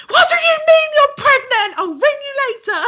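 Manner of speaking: high, loud, squealing disbelief; friend's high squeal of shock at the news. Retold or imitated. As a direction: imitate the friend's shrill shock, near tears. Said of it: "And she was like".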